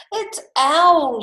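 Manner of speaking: high, loud storybook mouse; announcing mouse. Acted. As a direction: sing it out high.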